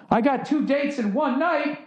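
high-pitched, hammy sitcom line; put-on high sitcom voice; sitcom trope character. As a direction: squeal it like a corny sitcom lead. Mocking sitcom trope catchphrase.

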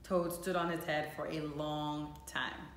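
plain reading voice

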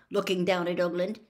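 ordinary delivery

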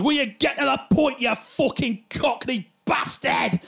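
high, slow Mancunian heckler's shout; hammed-up angry Manc. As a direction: heckle loudly in a put-on Manchester accent. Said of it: "you're a mank bastard".

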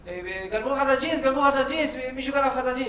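high-pitched wife raising the alarm; repetitive, urgent report in an imitated voice; mock alarm. Wife suddenly reports the theft.

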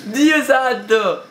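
high, drawn-out cry of shock; shaken outcry. Real, not acted. Player recovering from a jump scare, shaken outcry. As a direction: stretch it out high, still rattled.

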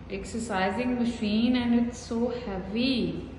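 usual speaking voice

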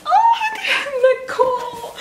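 high shrieking laugh around her name; laughing squeal. Real, not acted. A YouTuber squealing her friend's name through laughter. Opening a surprise gift box.

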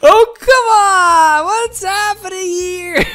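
whiny groan. Real, not acted. Partners mess up puzzle.

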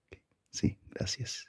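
soft whispered thanks. Acted. Thanking the clerk under his breath, whisper. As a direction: whisper it politely.

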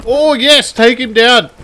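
high, loud battle cheer; excited cheering. Pretend. Gamer winning a fight, excited cheering. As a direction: cheer on the takedown.

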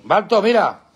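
high, sudden call to a dog. Real, not acted. Owner calling Balto to look, urgent call. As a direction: break off to grab the dog's attention.

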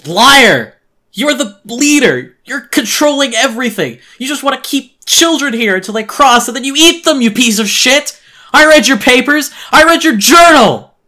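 very high, screamed accusation; strained, choked outburst. Acted. Boy accusing the man of leading it all, strained screaming. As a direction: scream it out in strained fury, at full volume. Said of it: "I choked on my strained reply".

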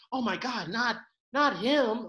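drawn-out, high mock dismay; mock shock. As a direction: act scandalized, slowing on the name. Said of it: "I wouldn't be like".

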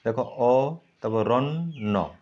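অ and রণ্য said apart, slowly; syllable by syllable. Shown as a demonstration. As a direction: break অরণ্য into অ then রণ্য. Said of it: "ভাঙো". (অরণ্য শব্দটি অ আর রণ্য করে ভেঙে বলা)

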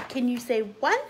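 ordinary delivery

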